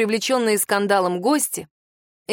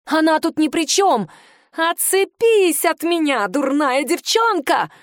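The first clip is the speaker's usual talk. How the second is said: shriek; 7 semitones higher than usual. A narrator's reading. Aunt shrieks at the girl hanging on her arm. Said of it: "взвизгнула Эйра Фонтен".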